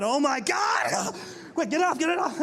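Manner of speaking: hammed-up alarm, get it off; panicked dad. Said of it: "start asking questions".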